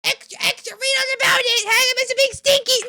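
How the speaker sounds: high-pitched headline hawking; mock newsboy cry. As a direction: cry it out like a paper seller.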